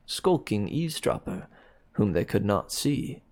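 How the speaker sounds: ordinary delivery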